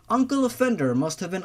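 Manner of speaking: ordinary delivery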